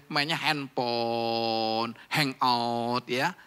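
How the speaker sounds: very slow, stretched-out words; mock idle drawl. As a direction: drag out each pastime teasingly. Mimics idle teenagers' pastimes, words stretched.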